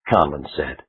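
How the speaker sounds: usual speaking voice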